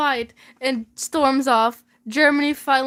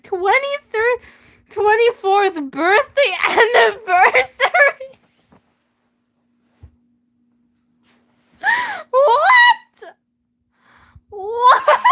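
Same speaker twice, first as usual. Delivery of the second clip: high laughing cry of disbelief; breaking into shocked laughter; 9 semitones higher, 5 dB louder, slower than usual. Real, not acted. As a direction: squeal it out in shock. Shocked laughter at absurd detail.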